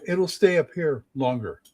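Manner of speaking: ordinary talk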